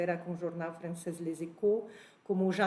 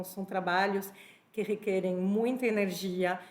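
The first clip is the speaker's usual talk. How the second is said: usual speaking voice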